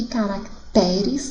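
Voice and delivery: caracteres slowed, with stress on TE; stress moved to TE. Shown as a demonstration. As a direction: slowly lean on "te" in caracteres. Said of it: "sílaba tônica te".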